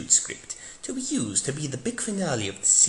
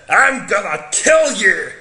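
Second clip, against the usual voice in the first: slow, much louder gruff Scottish bellow; mock Scottish threat; 7 semitones higher, 9 dB louder, slower than usual. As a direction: roar it like an angry Highland dad. Amy's father threatens the Doctor.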